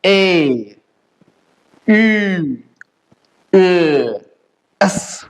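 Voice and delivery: ए, ई, ए drawn out with long gaps, then एस; spaced German vowels ending on एस; umlaut sound demo. Shown as a demonstration. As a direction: model each umlaut ए, ई, ए slowly. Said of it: "इसको क्या बोला जाता है".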